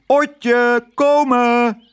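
high, long-drawn summons; sustained, high-pitched call. A narrator's reading. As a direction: holler it as if across a distance. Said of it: "Hij hing uit het portier en riep".

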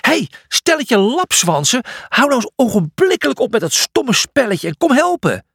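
high, indignant telling-off; exasperated, high-pitched rebuke; angry scolding. Acted. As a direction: yell at the slackers. Julius scolds his idle friends.

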